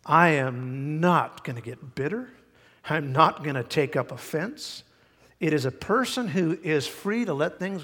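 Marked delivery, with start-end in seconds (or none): none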